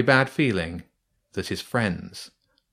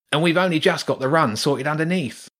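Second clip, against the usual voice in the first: high, lightly voiced rat worker; a busy rat explaining the job; rat workman; 7 semitones higher, 3 dB louder than usual.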